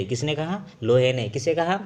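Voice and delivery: ordinary talk, nothing marked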